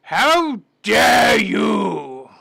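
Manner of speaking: loud, very slow hammed-up shout; mock outraged shout. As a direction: stretch it out like a scandalised officer.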